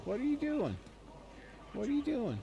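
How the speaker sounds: high-pitched doggy talk. Real, not acted. Streamer asks the dog what it's up to, high pet-talk voice. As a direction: coo high at a pet, drawing out the question.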